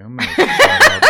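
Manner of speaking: high, loud, helpless laughter through the words; real laughing fit while talking. Real, not acted. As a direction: crack up at your own blunder. Embarrassed laughter at own bad analogy.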